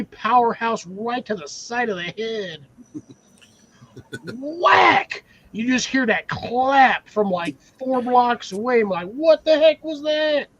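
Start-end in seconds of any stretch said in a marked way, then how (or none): 4.3-5.2 high, hammed-up shout; playful high-pitched smack effect; shouted impact word. Acts out the imagined slap landing. 9.1-10.5 high, drawn-out startled question; hammed-up slow disbelief; mock astonishment. Voices startled bystander blocks away.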